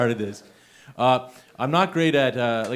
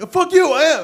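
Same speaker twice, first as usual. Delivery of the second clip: much higher, louder playful snap at the crowd; mock yelling; 10 dB louder than usual. Real, not acted. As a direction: yell back at the doubters in jest. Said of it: "Sorry, I'm, like, yelling at you guys".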